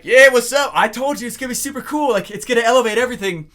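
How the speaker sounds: loud, high boast; answering the client, loud and smug; cocky bragging. Retold or imitated. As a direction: brag to the client. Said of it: "I'd be like".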